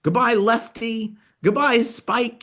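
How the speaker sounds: lightly acted weepy send-off, high and slow; tearful goodbye. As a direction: drag out the goodbyes like a teary kid. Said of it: "there's this tearful goodbye".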